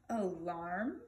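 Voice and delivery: sleepy question, low then rising; yawning. A narrator's reading. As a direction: yawn it out as a drowsy question. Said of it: "yawned the trumpeter".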